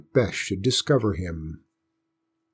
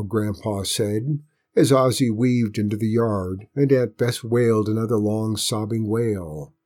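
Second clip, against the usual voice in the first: usual speaking voice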